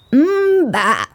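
imitated sheep baa; sheep bleat. Sheep teaches the kitten.

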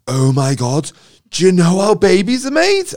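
put-on high, loud breathless kid blurting news; excited kid. Schoolboy rushing up to tell a secret, excited and loud. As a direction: gush it out like an eager schoolboy.

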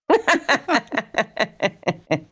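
high cackling witch laugh; witch cackle. Retold or imitated. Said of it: "the laugh is classic".

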